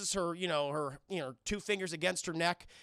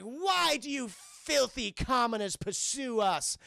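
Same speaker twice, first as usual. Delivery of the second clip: slow, higher theatrical disdain; haughty villain; 4 semitones higher, 3 dB louder, slower than usual. Retold or imitated. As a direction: address lowly pursuers with hammed-up scorn. Acts out possessed [name]'s disdain.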